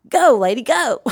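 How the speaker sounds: high, urgent mock cheer; impatient urging. Parent in the room silently willing the therapist on, impatient. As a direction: urge her on as if bursting to see it. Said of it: "feel somebody in the room going".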